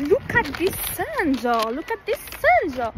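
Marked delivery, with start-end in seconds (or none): 0.1-1.4 mock English accent. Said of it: "mon accent". 1.7-2.7 higher, louder put-on English accent with "sun" stretched; French girl showing off her English accent; mock English accent. Playful mock English accent. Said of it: "mon accent".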